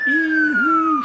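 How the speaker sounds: very high, loud wail; long piercing siren noise; shrieking siren. A narrator's reading. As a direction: wail like a fire engine. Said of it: "shrieked the big siren".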